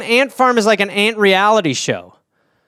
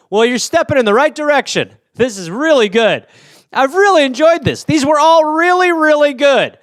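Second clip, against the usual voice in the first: gushing judge; 6 semitones higher than usual. Acted. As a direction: rave without any criticism. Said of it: "me as a judge".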